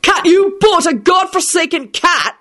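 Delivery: high, slow indignant shout. A narrator's reading. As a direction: explode at the news. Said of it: "shouted Quentin".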